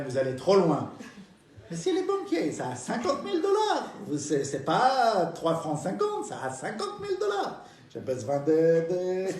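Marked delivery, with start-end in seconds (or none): 1.7-3.9 put-on old lady voice. 6.3-7.6 put-on old lady voice, higher.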